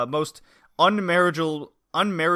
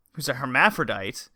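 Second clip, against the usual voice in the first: ordinary talk, nothing marked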